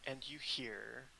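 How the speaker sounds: usual speaking voice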